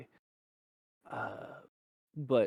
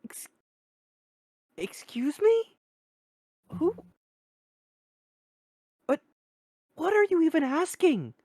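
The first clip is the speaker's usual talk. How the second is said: much higher, louder, baffled woman's voice; 8 dB louder than usual. Acted. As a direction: squeak out total confusion. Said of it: "she goes".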